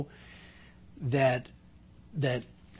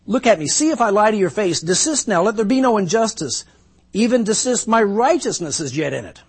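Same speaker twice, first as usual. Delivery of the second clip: louder, higher insistent demand; defiant plea; 6 semitones higher, 7 dB louder than usual. Acted. Job demanding his friends face him, insistent. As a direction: make them look you in the face. Job's defiant scripture quote.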